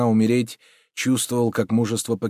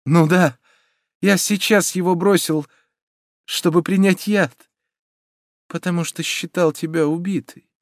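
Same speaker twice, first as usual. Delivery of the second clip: high, earnest explanation with pauses; impassioned lover explaining himself; fervent confession; 9 semitones higher than usual. A narrator's reading. Hero confesses to taking poison.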